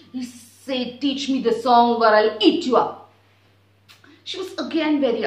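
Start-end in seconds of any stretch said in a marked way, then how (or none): 1.0-3.1 loud mock threat; bully the little bird; threatening demand. Husky threatens again.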